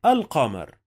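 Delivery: ordinary talk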